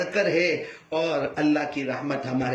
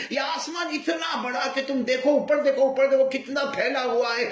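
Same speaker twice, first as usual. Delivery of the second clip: calling out; 8 semitones higher than usual. Acted. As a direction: lift the voice as if pointing at the sky. Father points child to sky.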